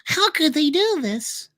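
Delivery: high, slow, pleading whine; mock outrage in a high voice; mock high whiny voice. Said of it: "And then you're like".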